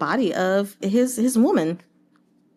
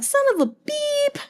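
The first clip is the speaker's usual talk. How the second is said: very high mock curse; bandit cursing. Bandit seeing the aide flee, high mock curse. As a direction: squeak out a censored curse. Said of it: "he's like".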